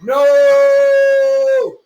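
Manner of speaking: shrill-high loud squeal of no. Acted. Gamer refusing in game, squealed high and loud. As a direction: yelp it way up high.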